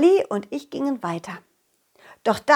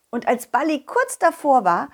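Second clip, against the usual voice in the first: ordinary delivery, nothing marked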